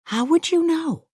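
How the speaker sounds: high-pitched incredulous question; disbelieving retort. A narrator's reading. Louise privately doubting the man's reassurance, incredulous.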